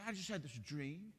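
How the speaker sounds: a hint of a wounded wife telling her dream; wife woken from a dream, lightly voiced and hurt; sleepy wife recounting dream. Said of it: "She said".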